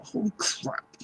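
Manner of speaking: soft, voiceless gasp of realization; hushed amazement. Pretend. Reader voices client's sudden realization, hushed amazement. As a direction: breathe it out, imagining their shock. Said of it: "You're gonna go".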